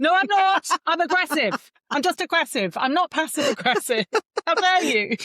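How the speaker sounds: pretend-affronted mother snapping back in jest; mock outrage. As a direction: play the offended mum, huffy and high. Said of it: "And I felt like saying".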